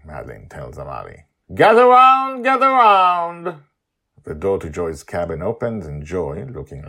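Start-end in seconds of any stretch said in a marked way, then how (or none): 1.5-3.6 loud high summons, drawn out; calling out. Acted. Father gathering the family on deck, loud drawn-out call. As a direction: summon the crew like a town crier. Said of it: "Justin calls again".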